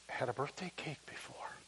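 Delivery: soft whisper, voice almost gone. Retold or imitated. Agnes overwhelmed by her first birthday cake, whispered. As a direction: breathe it out, overcome. Agnes moved, barely speaking.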